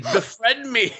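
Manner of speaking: high, hammed-up demand; desperate mock plea. Lonely boy begging the girls, high and hammed-up. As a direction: beg for a friend, overplayed. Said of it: "He's like".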